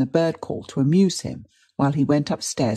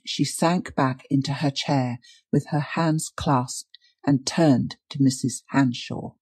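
ordinary delivery

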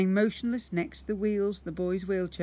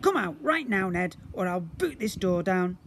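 higher, louder, annoyed; angry sister Jemima; demanding; 4 semitones higher, 5 dB louder than usual. Acted. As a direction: demand it through the door. Jemima threatening at door.

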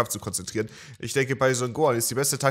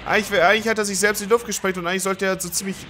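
ordinary delivery

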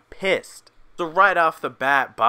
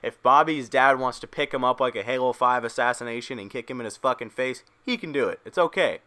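normal talking voice

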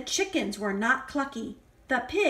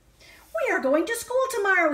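excited farmer; 7 semitones higher, faster than usual. Acted. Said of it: "Farmer Brown very excited".

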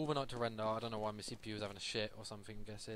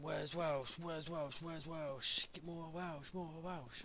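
higher, mock-nagging chant of viewers; repeated mock chant, higher pitched; mock chat spam; 6 semitones higher, much slower than usual. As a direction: parrot the demanding chat messages, pitched up.

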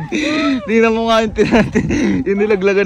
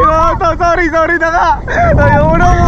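panicked pleading; 8 semitones higher, 4 dB louder, slower than usual. Real, not acted. Begging on a thrill ride.

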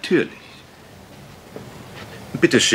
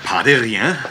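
French flourish; 2 semitones higher than usual. Acted. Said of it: "aber sind Sie Ausländer?".

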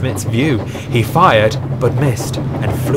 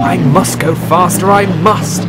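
urgent; 6 semitones higher, 4 dB louder than usual. A narrator's reading. Engine straining to escape attack.

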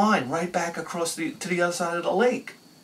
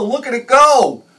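slower, high, loud marveling cheer; drawn-out glee; 9 semitones higher, 9 dB louder than usual. Retold or imitated. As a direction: stretch out "Look at it go" in awe. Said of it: "like I was five years old again".

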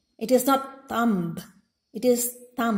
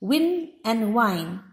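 ordinary delivery

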